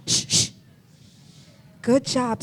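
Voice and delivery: ordinary talk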